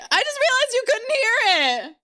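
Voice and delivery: half shouted through laughter; laughing. Real, not acted. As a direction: laugh out the realization. Laughing at silent video.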